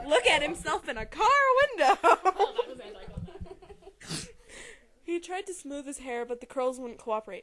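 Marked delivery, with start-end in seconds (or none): 2.0-4.7 high, breathy laughter; rising giggle. Real, not acted. Reader still laughing at the car window. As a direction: keep giggling.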